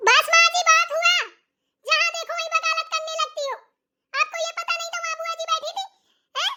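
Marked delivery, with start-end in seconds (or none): 0.1-4.4 loud, higher protest; sharp retort. Acted. Daughter-in-law cuts off her mother-in-law, loud and high. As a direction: cut her off hard.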